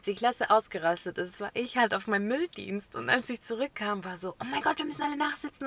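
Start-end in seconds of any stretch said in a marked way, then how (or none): 4.4-5.7 mock panic. Classmates panicking about detention.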